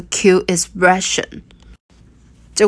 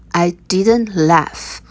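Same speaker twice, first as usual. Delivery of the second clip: slow, careful, every word spelled out; slow teaching read; slower than usual. Shown as a demonstration. Teacher slowing it down for learners, slow. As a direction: say it slowly so learners can follow. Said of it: "我没有笑".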